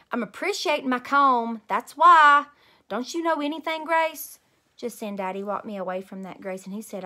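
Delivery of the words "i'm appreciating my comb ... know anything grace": loud, high grouchy snap; snappy. Acted. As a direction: snap back at her friend, fed up. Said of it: "I did a mad breath".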